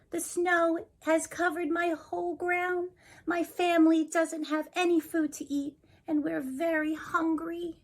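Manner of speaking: high, pleading rabbit voice; lightly voiced anxious rabbit; hungry rabbit. Rabbit character tells her trouble.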